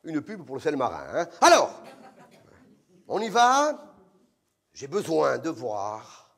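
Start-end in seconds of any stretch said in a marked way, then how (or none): 1.4-3.9 louder, higher call to action; urging director. Retold or imitated. Directeur presse l'acteur. 4.7-6.3 much lower and very slow; breathy director. Retold or imitated. Directeur imité.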